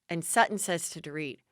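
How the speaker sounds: normal talking voice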